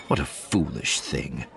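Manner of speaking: usual speaking voice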